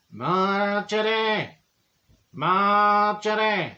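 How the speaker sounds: slow, drawn-out ghost voice; ghostly calling. Retold or imitated. Spirit calling Marjorie's name at the seance, slow and eerie. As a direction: call her name like a spirit from beyond.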